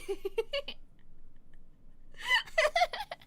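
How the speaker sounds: long, high giggle; real laughing fit. Real, not acted. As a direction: dissolve into helpless laughter. Laughs at own reading habit.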